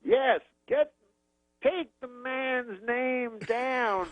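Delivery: very slow, high-pitched impression; Bill Cosby. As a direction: give orders in drawn-out, halting fragments. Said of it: "He goes".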